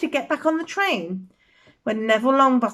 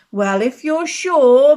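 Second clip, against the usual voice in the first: hat giving in, slow and louder; slow, louder hat voice, making up its mind; small voice; 7 dB louder, slower than usual. Acted. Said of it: "said the small voice".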